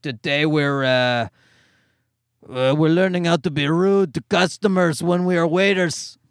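put-on low, smoky French-accented kids' entertainer; thick French accent, smoker. French Blippi teaching kids rudeness, low thick French accent. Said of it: "Sound like you're smoking a pack of cigarettes".

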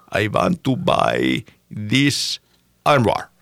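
put-on slow, drawn-out Russian accent. Old Russian buyer haggles in a slow Russian accent. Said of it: "Your, your Russian American accent was on point".

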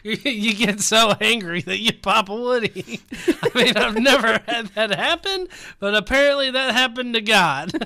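loud, high, cracked-up delivery; laughing while talking. Real, not acted. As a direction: push the joke out through real laughter.

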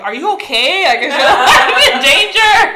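high, loud worried question breaking into laughter; mock alarm. Storyteller acting out onlookers checking on a struggling man, mock alarm.

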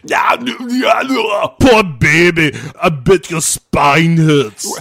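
put-on very slow, loud shouted Austrian accent; Arnold impression. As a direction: bellow it like Schwarzenegger, Austrian accent. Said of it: "that Teutonic accent of his".

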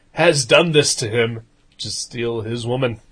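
ordinary talk, nothing marked

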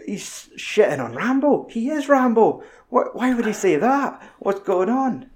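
high, incredulous questioning; mock disbelief. Real, not acted. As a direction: sound baffled at the absurdity.

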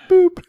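loud, very high "boop"; short piercing toy-like boops; mock beep. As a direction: squeak it out like a machine beep. Imitating an electronic beep.

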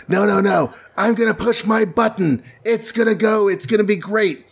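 a smug little villain about to push his button; gloating villain. Retold or imitated. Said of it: "He's like".

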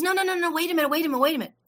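high, rapid-fire protest; urgent interruption. Retold or imitated. Friend stopping her refusal, high and insistent. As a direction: cut in eagerly to stop a refusal. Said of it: "She goes".

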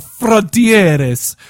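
"Frontieres" stretched like an Italian word, fron-TIE-res; Italian accent. Shown as a demonstration. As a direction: say "Frontieres" with an Italian lilt, rolled r. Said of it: "I said that in an Italian accent".

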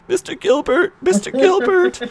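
very high squealed call; mock cry for help. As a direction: squeal for the teacher, hammed-up. Imitating Will calling the teacher.

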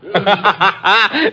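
high sadistic laughter; cruel laugh. Acted. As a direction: laugh cruelly over a wounded victim. Gunman leaves wounded constable.

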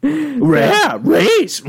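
high, loud cartoon dog voice; garbled talking-dog impression; Scooby. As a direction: yelp the words like a cartoon dog.

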